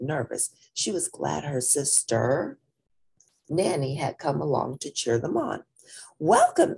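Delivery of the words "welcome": high, loud emcee greeting; friendly announcer. Acted. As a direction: boom it out to the crowd. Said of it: "a friendly man with a microphone announced".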